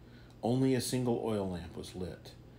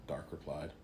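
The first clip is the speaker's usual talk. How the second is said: usual speaking voice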